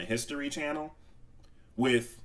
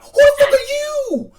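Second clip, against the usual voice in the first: much higher, loud shout; mock outrage; 8 dB louder than usual. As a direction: yell in exaggerated confusion. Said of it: "I'm like".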